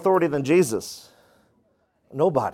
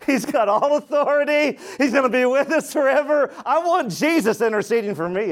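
high-pitched, insistent sermon delivery; preacher's raised, urgent pitch; impassioned preaching; 10 semitones higher than usual. Real, not acted. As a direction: argue it to the congregation with conviction. Preaches in answer to doubters.

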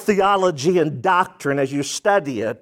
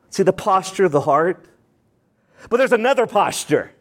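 ordinary talk

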